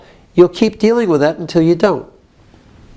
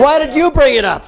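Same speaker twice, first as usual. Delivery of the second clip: higher-pitched angry demand; modelled accusation; 5 semitones higher than usual. Shown as a demonstration. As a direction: turn it into a sharp reproach.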